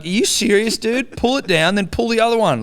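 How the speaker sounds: exasperated instructions. Retold or imitated. Son coaching his dad through a zip, exasperated. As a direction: talk Dad through it in disbelief.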